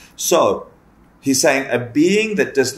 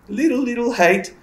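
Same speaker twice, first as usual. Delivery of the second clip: tiny high voice; 10 semitones higher than usual. Shown as a demonstration.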